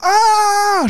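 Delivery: long, high-pitched scream; drawn-out high yell; imitated scream. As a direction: act out the man screaming. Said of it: "schreit einfach so".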